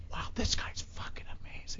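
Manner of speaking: hushed amazement at a strutting bassist; awed whisper. Retold or imitated. Whispering to himself, amazed by the bassist onstage. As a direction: whisper it in awe. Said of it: "I was like".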